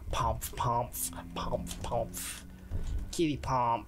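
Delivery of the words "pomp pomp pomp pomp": soft sneaky whisper; playful whisper. Pretend.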